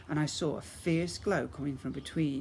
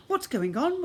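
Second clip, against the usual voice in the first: high, loud alarmed cry; 13 semitones higher, 6 dB louder than usual. Acted. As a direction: call out in alarm to the garden. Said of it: "Mum cried".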